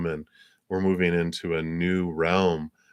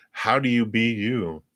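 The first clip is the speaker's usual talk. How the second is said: ordinary talk, nothing marked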